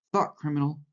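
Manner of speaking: high, half-voiced mock shout; children's shout. A narrator's reading. Children leaping around Winston, high mild shouting. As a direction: call it out high without much volume.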